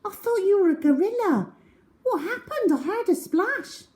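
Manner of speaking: breathless relief and curiosity; girl asking what happened; Cherry excited. A narrator's reading. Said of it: "said Cherry".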